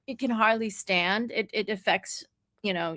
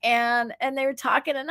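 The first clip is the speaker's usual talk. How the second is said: normal talking voice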